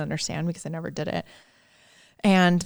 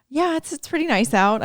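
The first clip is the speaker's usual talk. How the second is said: high, breezy polite reply; playing along; 10 semitones higher, 4 dB louder than usual. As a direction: answer the clerk as if nothing is wrong. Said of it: "I was like".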